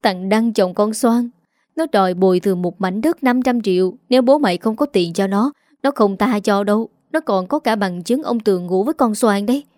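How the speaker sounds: ordinary delivery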